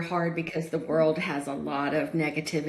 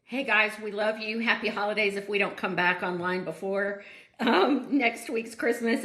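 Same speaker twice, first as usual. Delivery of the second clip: usual speaking voice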